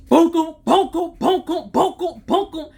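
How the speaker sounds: mock pounding sounds. Imitating trainee pounding dummy.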